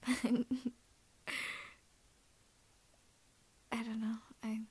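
soft confessional whisper; ASMR whisper. Acted. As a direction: whisper it tenderly, close in.

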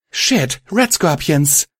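high, slow shouted warning; alarmed shout. Acted. Violet spots red scorpions approaching the camp, shouted warning. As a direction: shout out the danger. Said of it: "Violet shouted".

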